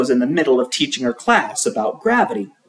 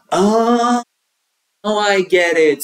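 realization; 6 semitones higher than usual. A narrator's reading. Kid realizes.

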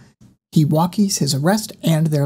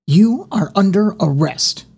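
higher, firm police officer's declaration; serious arrest announcement; 6 semitones higher, 4 dB louder than usual. A narrator's reading. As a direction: announce the arrest with stern authority. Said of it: "In a very serious tone".

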